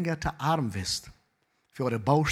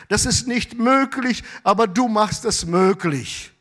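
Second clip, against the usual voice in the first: raised, loud, fervent preaching; full-force preaching; 8 semitones higher, 4 dB louder than usual. Acted. Pastor urging the congregation, full-force fervent preaching. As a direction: preach it with rising fire.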